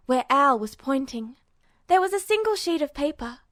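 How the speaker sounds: plain reading voice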